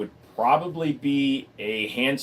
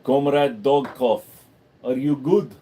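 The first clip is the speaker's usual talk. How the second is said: mock Russian accent; 4 dB louder than usual. Playfully addressing viewer as Comrade Dogkov.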